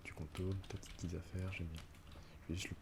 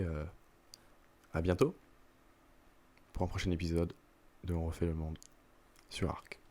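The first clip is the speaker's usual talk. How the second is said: loud, upbeat sign-off; video outro; 6 dB louder than usual. Acted. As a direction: wrap up the episode for viewers. Outro of the episode.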